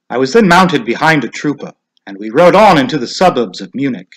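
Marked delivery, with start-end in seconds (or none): none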